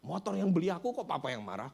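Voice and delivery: acted-out retort to a father; scolding back. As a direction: imitate a child telling Dad off. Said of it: "Dia marahin balik bapaknya".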